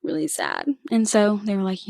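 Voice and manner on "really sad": high, soft, pitiful whimper; small pouty voice, pitched up; whiny sad quote. Retold or imitated. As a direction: play it up like a sad new driver begging. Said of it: "I was like".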